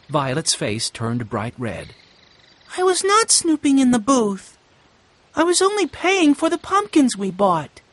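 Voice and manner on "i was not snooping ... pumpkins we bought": put-on much higher girl's voice, hurt denial; indignant young girl Violet. A narrator's reading. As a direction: defend yourself, embarrassed and upset. Violet's voice in narration.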